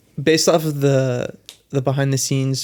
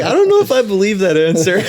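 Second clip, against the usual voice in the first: higher, louder, quick doubtful voice; skeptical; 6 semitones higher, 5 dB louder, faster than usual. Retold or imitated. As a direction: push back, unconvinced and quick. Doubtful hero quoted in story.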